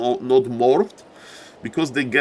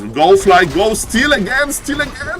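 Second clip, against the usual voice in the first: rising excited cheer, higher and louder; excited urging; 8 semitones higher, 5 dB louder than usual. Real, not acted. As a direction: urge him on, climbing higher each time. Caster urging a hero to steal.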